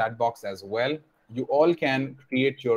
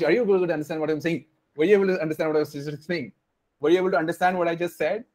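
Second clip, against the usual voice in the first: fast talking; 4 semitones higher, faster than usual. Shown as a demonstration. Teacher showing students how too-fast speech sounds, fast. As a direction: rattle it off quickly. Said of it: "if I speak very fast".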